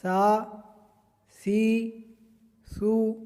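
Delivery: ثا with no whistle, soft lisped th; soft tha. Shown as a demonstration. Teacher shows ثا without a whistle, soft th. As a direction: keep ثا soft, no hiss. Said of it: "سیٹی کی طرح آواز نہیں آنی چاہیے".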